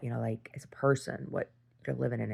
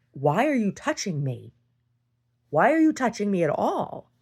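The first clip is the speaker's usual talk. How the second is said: angry protest; 6 semitones higher, 6 dB louder than usual. Retold or imitated. Retelling being grabbed.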